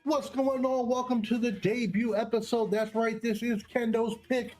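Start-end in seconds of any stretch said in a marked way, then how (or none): none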